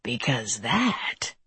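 low, slow, soft creature whisper; menacing whisper. A narrator's reading.

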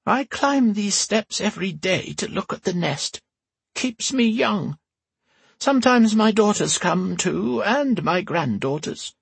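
put-on high creaky old empress, dry; old woman voice. A narrator's reading. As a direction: tart old woman's retort. Whisper's voice held.

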